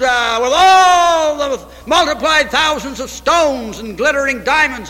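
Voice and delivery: impassioned pulpit crescendo; rising preacher fervour. Acted. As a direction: build the contrast of colt and crown. Building preaching run.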